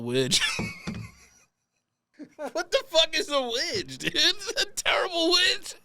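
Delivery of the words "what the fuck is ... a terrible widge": high-pitched, incredulous through laughter; cracking up. Real, not acted. Host baffled by the word widge, cracking up. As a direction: keep restarting as laughter breaks up the words.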